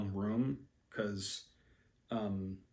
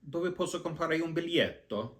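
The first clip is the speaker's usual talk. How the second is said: tourist Italian, pitched higher; Italian phrase; 6 semitones higher than usual. Retold or imitated. Tourist asking for a ticket in Italian, pitched higher. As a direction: try out your Italian on a local. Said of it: "I would try to use it".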